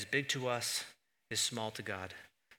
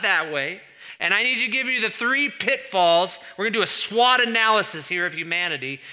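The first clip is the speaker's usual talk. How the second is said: higher, louder hammed-up boss calling his advisors; mock boardroom God; 7 semitones higher, 11 dB louder than usual. Preacher mocking God as a CEO with advisors, loud and hammed-up. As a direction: play God as a manager running a meeting. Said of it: "He doesn't go".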